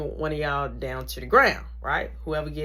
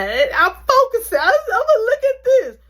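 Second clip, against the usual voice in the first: intense focus; 9 dB louder than usual. Retold or imitated. Herself concentrating before the kick.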